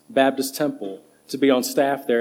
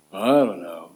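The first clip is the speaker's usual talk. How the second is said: daughter's reply; 7 semitones lower than usual. Retold or imitated. Daughter answering dad on the drive home, low imitated shrug. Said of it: "I'll ask my daughters".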